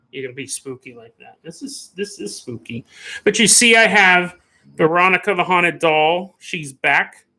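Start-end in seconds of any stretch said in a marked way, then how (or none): 0.1-2.8 very soft, low spooky voice; hushed spooky. Shown as a demonstration. Host showing viewers what spooky is, hushed. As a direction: drop right down to an eerie hush.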